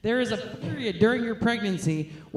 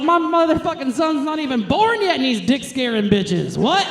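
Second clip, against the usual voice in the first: high loud boastful yelling; proud triumphant shout; 8 semitones higher, 3 dB louder, slower than usual. Retold or imitated. Proud mother shouting to the world, triumphant. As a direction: crow it to the whole world. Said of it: "I was like".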